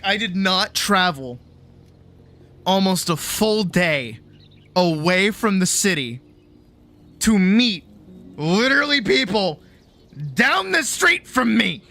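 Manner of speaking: hammed-up rant climbing to a high-pitched yell; mock outrage. Traveller meeting neighbours from home in Paris, mock outrage building.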